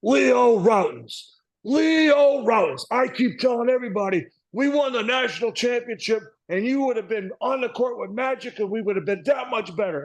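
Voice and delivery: imitating an old college coach proclaiming over the phone; hammed-up voice of the old coach calling from his office; booming coach voice. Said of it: "he had this deep, booming voice like this".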